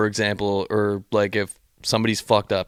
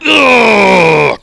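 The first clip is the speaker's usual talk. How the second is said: loud, high straining grunt; mimicking crushing negative energy with a loud grunt; effort grunt; 10 semitones higher, 10 dB louder than usual. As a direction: push it out like the last push-up. Crushing the anger.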